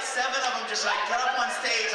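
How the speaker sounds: ordinary talk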